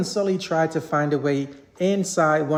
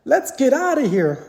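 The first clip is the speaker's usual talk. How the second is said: higher, louder panicked shout; 8 semitones higher, 4 dB louder than usual. A narrator's reading. Mike fleeing the screaming kids, shouting. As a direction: yell it as you flee. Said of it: "Mike shouted".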